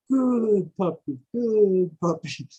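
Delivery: slow, drawn-out pet-the-puppy coo; imitating someone calmly praising a puppy; mock soothing. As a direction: coo slowly as if petting a dog. Inmate petting the attack dog, retold.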